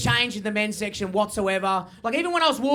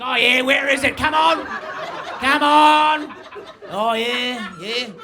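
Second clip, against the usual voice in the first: full grub mode; 2 semitones higher, 4 dB louder, much slower than usual. Acted. Man prowling the men's section, full grub mode shouting. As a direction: drag out a hammed-up, grubby "come on". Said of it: "just in full grub mode".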